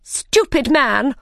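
high, drawn-out angry scolding; Queen's shout. A narrator's reading. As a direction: scold him as a furious Queen. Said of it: "shouted the Queen".

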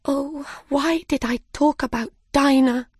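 high, regretful lament; crying out. Acted. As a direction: wail mildly, blaming yourself. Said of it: "cried Alice".

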